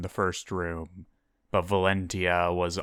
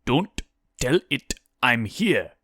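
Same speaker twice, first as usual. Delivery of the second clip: hissed; 4 semitones higher, 5 dB louder than usual. A narrator's reading. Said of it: "Elias hissed from behind a coffin".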